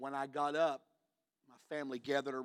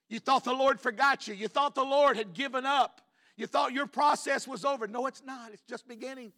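urgent preacher's encouragement; impassioned preaching; 11 semitones higher, 8 dB louder than usual. Real, not acted. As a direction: preach it high and forceful, then drop the volume.

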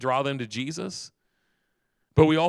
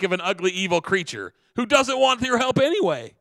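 high, loud exasperated scolding; scornful shout; 6 semitones higher, 5 dB louder than usual. A narrator's reading. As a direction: berate the old man loudly from afar. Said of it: "he shouted to him".

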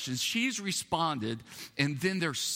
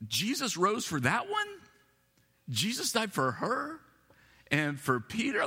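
incredulous angels; 6 semitones higher than usual. Retold or imitated. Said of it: "maybe they were thinking".

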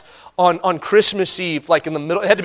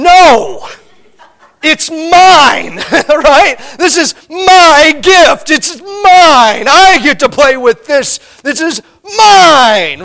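put-on high, loud and slow, a bratty kid clutching the toy; child refuses a sibling's request for the toy, loudly possessive; mock possessive child; 7 semitones higher, 7 dB louder, much slower than usual. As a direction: drag out mine like a child who won't share.

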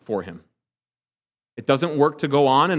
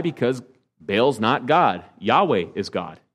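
ordinary talk

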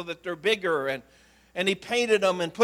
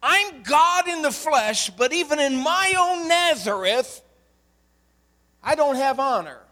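voicing Jesus; 6 semitones higher, 3 dB louder than usual. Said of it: "That's another way of saying".

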